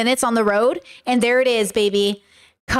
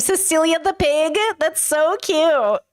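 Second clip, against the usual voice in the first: a touch of slow, cutesy cooing; 4 semitones higher, slower than usual. Real, not acted. Streamer cooing at a cute pig's name, slow. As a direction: coo over the pig's name, drawn out.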